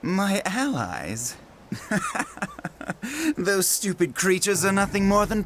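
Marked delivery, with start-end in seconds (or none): 1.7-3.3 short, soft, mocking chuckle; a quiet, contemptuous laugh; scornful chuckle. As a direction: scoff at the very idea. Villain scoffs at the dragons.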